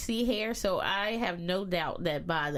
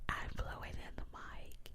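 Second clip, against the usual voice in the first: whispering; 12 dB quieter than usual. Real, not acted. Whispering over mic blowing.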